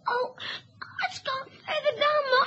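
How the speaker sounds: usual speaking voice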